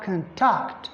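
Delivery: "contact" with reduced first syllable, TACT stressed; stress on second syllable. Shown as a demonstration. Teacher giving the verb form, TACT stressed in "contact". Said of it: "If it is a verb".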